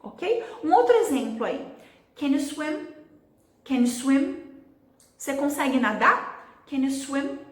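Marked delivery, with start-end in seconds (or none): 2.1-4.4 swim stands out, Can you shrunk to almost nothing; quick, reduced Can you, clear rising swim; stress on swim. Shown as a demonstration. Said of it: "Eu falei praticamente swim". 6.7-7.4 swim stands out, Can you shrunk to almost nothing; quick, reduced Can you, clear rising swim; stress on swim. Shown as a demonstration. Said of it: "Cadê o can e cadê o you".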